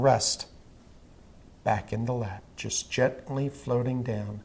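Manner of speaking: slow, soft, even hypnotist voice; hypnotic induction. Acted. Hypnotist guiding a trance.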